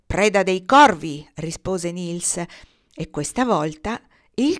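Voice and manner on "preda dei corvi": loud, slow announcement; defiant call. A narrator's reading. Boy answers the starling, defiant call. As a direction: proclaim it slowly and loudly. Boy shouts his name back.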